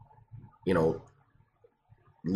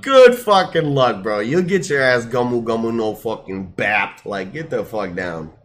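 frat bro; 5 semitones higher, 8 dB louder than usual. Acted. Bro voice telling Kinemon off.

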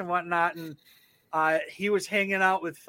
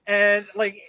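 ordinary talk

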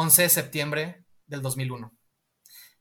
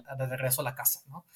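ordinary delivery

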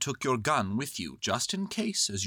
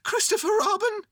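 high, loud call; 6 dB louder than usual. A narrator's reading. Bear up high to boy below, high call. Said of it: "he called down to you again".

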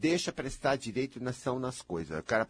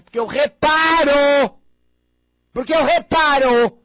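shouted, slow and much higher, insisting; emphatic shout; 13 semitones higher, 15 dB louder, slower than usual. Real, not acted. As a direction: hammer it home at full voice. Bronca enfática, repetida aos gritos. (gritando com ênfase, devagar)

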